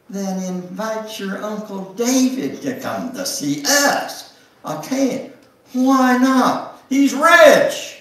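high, rising to loud demands; insistent wife. Acted. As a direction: press him harder each line. Emily pushes Jimmy in dialogue.

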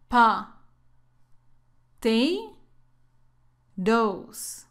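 "Pa", "Te", "Dos" as separate English syllables, long pauses between; teacher's drill, each of "Pa. Te. Dos." said alone. Shown as a demonstration. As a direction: break potatoes into "Pa. Te. Dos.". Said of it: "practiquemos la pronunciación de potatoes".

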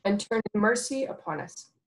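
ordinary talk, nothing marked

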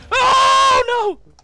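high, loud cry of no; dismayed shout. Real, not acted. Killed suddenly in the match, a loud high cry. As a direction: yell in real dismay.

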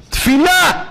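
high, loud call of prayer; crying out. Real, not acted. Preacher cries out to the congregation, loud high call. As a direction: cry the word out to the hall.

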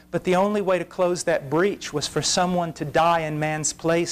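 ordinary delivery

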